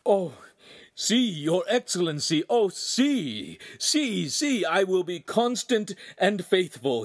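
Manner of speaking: high, halting stammer of relief. A narrator's reading. Grateful servant stammering to the Podesta, high and halting. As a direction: stumble over the words, overwhelmed. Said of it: "finally finding words".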